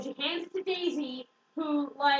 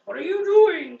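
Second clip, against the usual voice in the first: loud, higher hammed-up protest; mock outraged shout; 4 semitones higher, 12 dB louder than usual. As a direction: shout it like a scandalised character. Acted outburst in retold dialogue.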